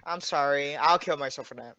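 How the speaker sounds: low, quiet mock apology; sarcastic apology. Player to annoyed friend after one-tapping him, sarcastic. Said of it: "I was being sarcastic".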